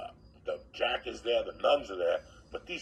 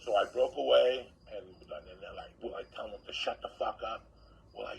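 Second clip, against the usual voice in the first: ordinary talk, nothing marked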